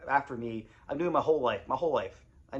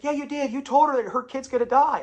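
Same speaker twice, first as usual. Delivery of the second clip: much higher, loud retort; insisting; 12 semitones higher, 8 dB louder than usual. Retold or imitated. Church lady insisting to Martin, loud and high. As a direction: throw it back at him.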